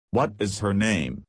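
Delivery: plain reading voice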